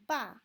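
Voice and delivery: loud "ba" falling sharply; short downhill "ba"; fourth tone. Shown as a demonstration. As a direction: drop "ba" straight down from high. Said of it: "one, two, three".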